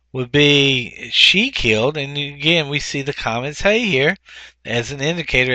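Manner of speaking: normal talking voice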